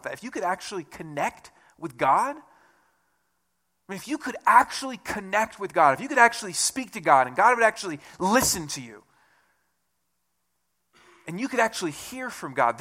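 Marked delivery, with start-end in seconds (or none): none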